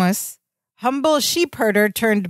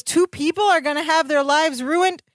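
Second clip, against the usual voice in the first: higher-pitched mounting disbelief, acted out; shocked; 6 semitones higher than usual. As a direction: count up the victims in mock shock, pitch climbing. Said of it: "Larry is shocked again".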